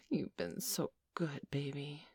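ordinary delivery

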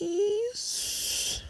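high, drawn-out please. Acted. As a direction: stretch the word up high like a plea. Drawn-out plea.

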